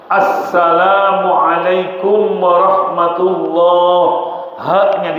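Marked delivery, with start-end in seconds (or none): 0.0-4.0 assalamualaikum warahmatullah slow, every letter clear with the alif-lam kept; correct salam. Shown as a demonstration. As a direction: spell out assalamualaikum warahmatullah slowly in full. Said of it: "Contoh yang benar".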